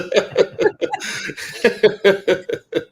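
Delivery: long high airy laugh; high, loud wheezing laughter; breathy laugh. Real, not acted. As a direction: crack up at the punchline. Laughing at the story.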